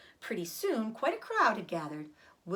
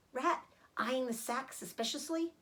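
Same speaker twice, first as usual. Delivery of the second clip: ordinary delivery, nothing marked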